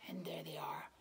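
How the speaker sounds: hushed reveal; whisper. Real, not acted. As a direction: whisper it like a secret. Showing picture to children.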